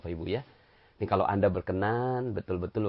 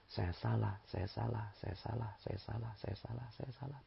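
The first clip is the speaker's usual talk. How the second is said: softer and fading, repeated admission; humble admission; 3 semitones lower, 12 dB quieter than usual. Shown as a demonstration. Preacher showing the congregation how to admit fault, softer and fading. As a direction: murmur it humbly, trailing away. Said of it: "Beliau selalu mengucapkan".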